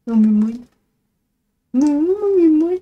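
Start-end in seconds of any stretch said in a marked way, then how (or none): 1.7-2.7 much higher squeaky pretend voice; high cat voice. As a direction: speak for the cat, whiny. Mock reply voiced for cat.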